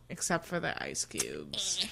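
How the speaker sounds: whispered aside; whispered confession about the drinks. Real, not acted. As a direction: drop to a whisper for the punchline. Confiding aside.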